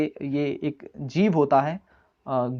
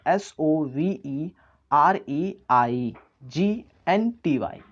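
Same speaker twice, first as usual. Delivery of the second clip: slow spelling; much slower than usual. Shown as a demonstration. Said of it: "spelling है".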